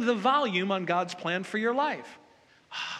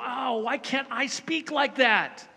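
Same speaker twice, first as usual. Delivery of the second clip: envious whine; 5 semitones higher, slower than usual. Retold or imitated. Preacher mimics own envious whine.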